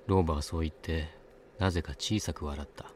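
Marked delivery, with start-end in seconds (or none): none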